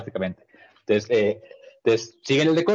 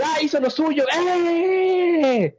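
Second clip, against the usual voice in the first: high, shouted fan cheer; high excited shout at a meme moment; much slower than usual. Retold or imitated. Imitating anime fan reaction.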